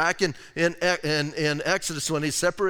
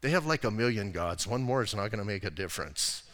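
retold whisper; 8 semitones lower, 7 dB quieter than usual. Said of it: "came up and whispered to me".

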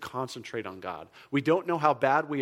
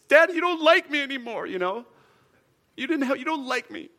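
put-on whiny child voice, higher and louder; imitating his kids; 11 semitones higher, 5 dB louder than usual. Kids whining at their dad, mock sulky. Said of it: "I can hear my kids".

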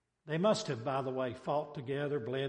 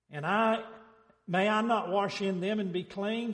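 angry Naaman; 6 semitones higher, 6 dB louder than usual. Acted. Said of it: "went away in a what? Rage".

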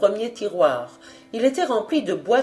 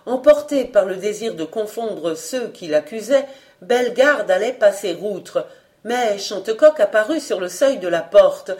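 normal talking voice

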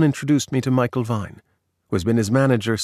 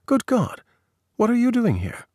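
high, exaggerated mock shock at a chance meeting; mock astonishment; 12 semitones higher than usual. Stranger feigning shock at bumping into an old acquaintance, hammed-up astonishment. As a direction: pitch jumps way up in faked disbelief.

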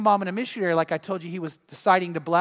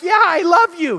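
higher, louder mock husband voice; husband's reply; 9 semitones higher, 8 dB louder than usual. Husband answering wife, hammed-up loud reply. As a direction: play the pleased husband. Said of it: "He's like".

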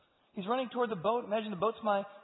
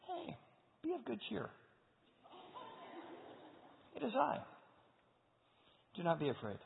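gentle reassurance; 10 semitones higher, 8 dB quieter than usual. Acted. Jesus calming the disciples.

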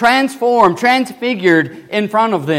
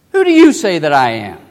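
louder, emphatic repeat of the question; forceful pointed question; 6 dB louder than usual. Acted. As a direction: press the question home loudly.

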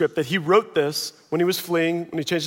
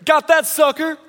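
high, smug bragging voice; hammed-up boastful David; gloating David; 8 semitones higher, 4 dB louder than usual. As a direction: brag like a cocky young king. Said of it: "he's like".

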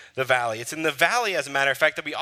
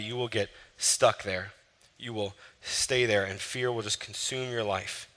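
ordinary talk, nothing marked

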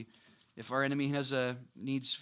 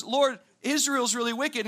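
complaining prophet; 8 semitones higher, 5 dB louder than usual. Retold or imitated. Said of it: "Habakkuk goes".

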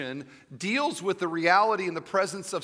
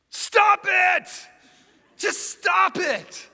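explosive shout, much higher and louder, second line slow; deadpan therapist suddenly yelling; 7 dB louder, much slower than usual. Retold or imitated. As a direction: blast it at the patient out of nowhere. Psychiatrist yells at patient.

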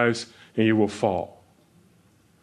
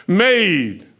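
boastful king; 6 semitones higher, 4 dB louder than usual. Retold or imitated. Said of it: "and he says, what?".

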